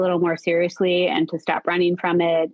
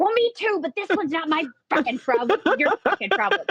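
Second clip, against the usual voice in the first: high-pitched exasperated comeback; retold yelling back; 10 semitones higher, faster than usual. Arguing back at her friend over the bracelets, high and exasperated. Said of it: "we just had to yell at each other a little bit".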